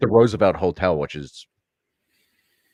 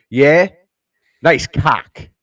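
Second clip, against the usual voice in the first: loud, higher questioning jab, then the punchline; acted brash heckle; 5 semitones higher, 8 dB louder, much slower than usual. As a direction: imitate a confrontational passerby. Acted confrontational passing stranger.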